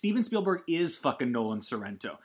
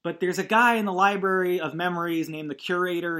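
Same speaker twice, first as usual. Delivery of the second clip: ordinary talk, nothing marked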